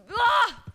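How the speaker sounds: sudden loud, high cry; frustrated yell. Real, not acted. Streamer botching the voice attempt, a frustrated high yell. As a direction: let out an exasperated "ah".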